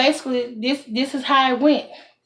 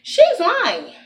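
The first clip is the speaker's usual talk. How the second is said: higher "she's lying" with a laugh; laughing accusation; 8 semitones higher than usual. Real, not acted. Telling viewers again, laughing, pitched higher. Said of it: "Now I'm self telling them".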